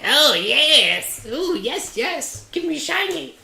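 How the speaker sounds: very high squeaky second head of an ogre; gleeful falsetto creature voice; high-pitched. Acted. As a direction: squeal it eagerly, far up in pitch. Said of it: "High pitched".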